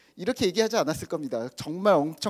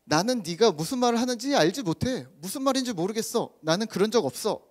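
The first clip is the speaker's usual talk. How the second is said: higher-pitched, protesting denial; acted denial; 6 semitones higher than usual. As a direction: insist you know nothing about it. Acts out Peter's denial in everyday words.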